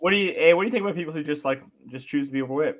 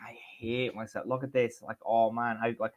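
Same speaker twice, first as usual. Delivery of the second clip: much softer, slow, low self-loathing mumble; miserable mutter; 4 semitones lower, 7 dB quieter, slower than usual. Retold or imitated. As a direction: sigh it at the mirror, defeated. Mocking self-hating mirror talk.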